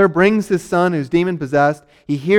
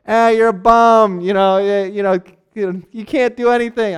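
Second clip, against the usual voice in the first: mock heckling; 3 semitones higher, 3 dB louder than usual. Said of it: "these people yell at each other like".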